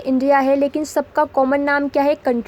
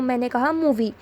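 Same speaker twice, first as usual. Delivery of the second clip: ordinary delivery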